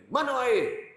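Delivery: hammed-up husband demanding water; gruff demand.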